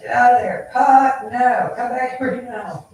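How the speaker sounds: loud, high yell to the dog; calling from far away. Retold or imitated. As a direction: shout the dog's name to stop him. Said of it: "calling him in the yard".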